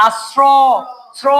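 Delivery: আশ্র, শ্র with the closing o held long; drawn-out final vowel. Shown as a demonstration. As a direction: stretch the o ending of আশ্র, then repeat শ্র alone. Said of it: "কিন্তু এখানে উচ্চারণ হয়েছে".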